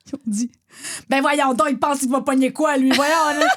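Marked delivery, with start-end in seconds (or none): 1.1-3.5 loud, raised-pitch outburst of disbelief; loud mock heckle from the next table; retold indignant yelling. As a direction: imitate onlookers hollering in outrage. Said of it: "ils ont gueulé, genre".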